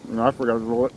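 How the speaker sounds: chewing while talking; talking between bites; mouth full. Real, not acted.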